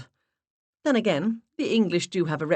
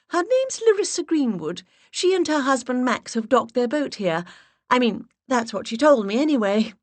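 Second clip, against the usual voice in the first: put-on higher and louder, stronger accent; high breathy put-on voice; 8 semitones higher, 5 dB louder than usual. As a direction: voice raised an octave, stronger English accent. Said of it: "I raised my voice an octave and increased the strength of my accent".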